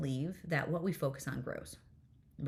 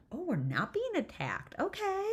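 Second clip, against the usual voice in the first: high little voice of dawning relief; relieved nervous system; 14 semitones higher than usual. Acted. Mom voicing her own nervous system calming, high relieved voice. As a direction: lift the pitch right up into a squeaky okay. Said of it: "my nervous system was like".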